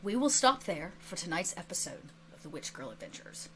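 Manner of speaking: low, soft, suspenseful narration with pauses; quiet, weighty cliffhanger ending; game master sign-off. Acted. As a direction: close the episode in a hushed storyteller's voice. Game master's solemn episode ending.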